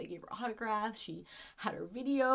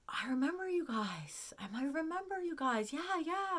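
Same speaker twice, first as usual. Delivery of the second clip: acted-out delight, voice lifting higher; retold excited recognition; 7 semitones higher than usual. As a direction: relive the moment of suddenly recognising them. Recognising visitors in a retold exchange.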